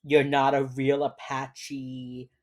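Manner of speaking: slow, drawn-out retold accusation; slowly voiced, putting up a strong front; trying to be strong. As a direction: draw out every word, holding steady. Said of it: "she's trying to be strong".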